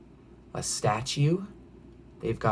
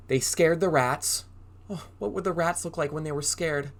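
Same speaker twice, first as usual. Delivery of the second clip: ordinary delivery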